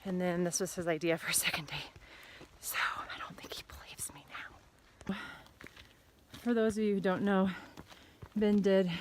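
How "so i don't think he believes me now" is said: hushed whispered aside; whispering. Real, not acted. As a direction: whisper it like a secret. Confiding aside to camera.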